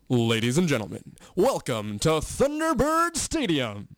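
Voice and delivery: slow, booming arena announcer with a big pitch lift; welcoming the crowd in a grand announcer voice; stadium announcer voice. Shown as a demonstration. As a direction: stretch every word out like a stadium PA call. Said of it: "now that's my announcing voice".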